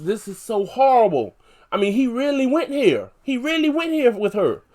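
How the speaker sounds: genuinely appalled by the plot; real dismay. Real, not acted. Recapping a horrifying scene.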